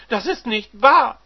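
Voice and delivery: screaming wife. Acted. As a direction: shriek the denial again.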